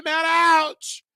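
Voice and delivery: high squeaky mock ouch; lightly voiced whiny fan; mock pained viewer.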